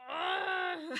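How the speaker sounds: loud, high exasperated groan; a fed-up shout of disgust; frustrated ugh. Retold or imitated. Mock groan at mosquito bites. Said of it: "And I'm just like".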